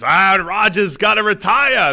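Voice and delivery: high, loud yell, dragged out slow; ranting fan impression. Mimicking last week's radio rant about Rodgers, mock outraged shout. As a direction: bellow it like an outraged radio caller. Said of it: "last week it was".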